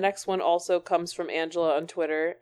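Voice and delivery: ordinary talk, nothing marked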